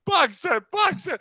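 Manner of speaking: high, loud repeated yell; imitated two-note excited shouting; car-alarm yelling. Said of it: "like he's a car alarm".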